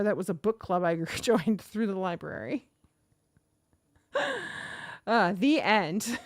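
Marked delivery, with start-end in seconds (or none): 4.1-5.0 real burst of high laughter; amused high giggle. Real, not acted. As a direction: laugh it off, much higher. Laughing at her own story.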